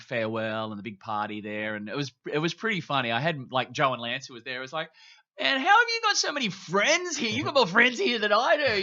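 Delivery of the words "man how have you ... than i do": high, loud imitation of an astonished friend; loud mock amazement. Imitating a friend at his farewell party, loudly amazed. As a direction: exclaim it in disbelief, pitched way up.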